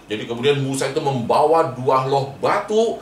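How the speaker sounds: plain reading voice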